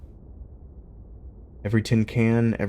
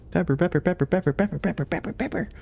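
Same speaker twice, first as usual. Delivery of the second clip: very high, softer singsong; 13 semitones higher, 4 dB quieter than usual. Real, not acted. Owner cooing her dog's name, high soft baby talk. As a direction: coo at the dog, rising higher.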